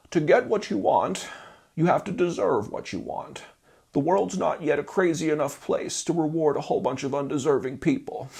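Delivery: put-on Charlie Munger accent. Vlogger quoting Munger to musicians, imitating his accent. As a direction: play the blunt old investor in his own voice. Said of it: "I'll try to do it in a Charlie Munger accent".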